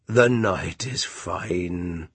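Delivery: very slow, smooth walrus voice; oily walrus. Acted. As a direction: purr it slowly. Walrus distracts.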